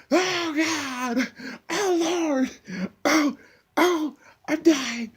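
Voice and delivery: very high mock wailing through laughter; slow, hammed-up wail of Oh, dying; pitiful moaning. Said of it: "in the attempt to look pitiful".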